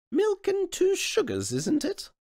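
high sweet innocent question; high royal feigned innocence; mock-polite queen. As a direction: ask innocently after the prank.

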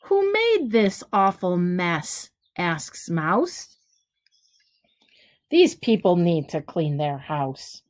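gentle storybook read-aloud, each rhyme given room; reading to children. Acted. Teacher reading a picture book to kids, lively storytelling. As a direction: read it like bedtime story time for little ones.

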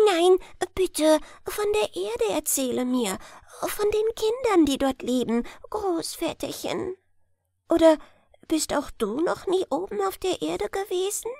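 high, eager little hare; eager grandson. Acted. Little hare begging grandfather for stories, high and eager.

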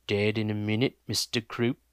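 a hint of a dull menacing heavy; flat voice. Thug answers his partner in a flat deadpan. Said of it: "said the flat voice".

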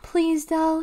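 high, sweetly coaxing plea; sweet pleading. A narrator's reading. Brother begs to come along, sweetly. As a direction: coax her sweetly, pitched well up. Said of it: "he said sweetly".

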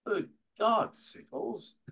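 high-pitched posh English exclamation; English con man. Retold or imitated. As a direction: gasp it out like a startled English gent. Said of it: "and I go".

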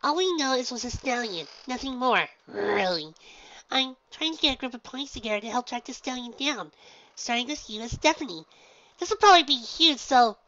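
very high, loud bubbly pony voice; Pinky, exclaiming. Acted. Pinky introduces herself to the gym leader, very high and bubbly.

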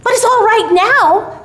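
put-on high, eager child voice; hopeful little boy. A narrator's reading. Billy tells Santa all is well, in a high hopeful voice. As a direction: reassure himself like a hopeful child. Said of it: "suggested little Billy".

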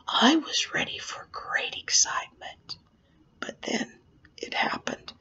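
very soft, breath-held whisper; suspenseful storytelling. Acted. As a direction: whisper the build-up to the big reveal. Suspenseful hush before the reveal.

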